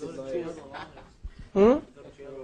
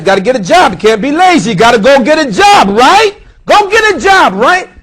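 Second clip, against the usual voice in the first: rising louder and higher to a fervent peak; building preaching; 10 semitones higher, 13 dB louder than usual. Real, not acted. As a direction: drive the point home harder each time.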